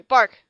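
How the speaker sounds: bark. Acted. Dog bark.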